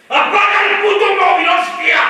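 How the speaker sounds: explosive shouted command; furious shout. Shown as a demonstration. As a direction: bark the order with the whole body. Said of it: "Eso sería acción verbal".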